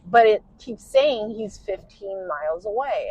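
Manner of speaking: ordinary talk, nothing marked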